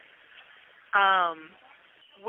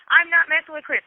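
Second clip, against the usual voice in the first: higher, louder retold protest; indignant denial; 7 semitones higher, 7 dB louder than usual. Friend denying it to her man mid-argument, indignant. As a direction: act out an emphatic, high-pitched denial.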